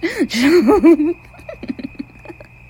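loud burst of gloating laughter; villain's cackle. Acted. Killer mocking a captive, loud cackle.

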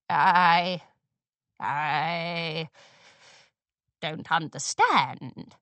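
put-on faltering low old mare; unsure old mare. A narrator's reading. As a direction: stammer it, lost for words. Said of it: "sounding unsure for the first time".

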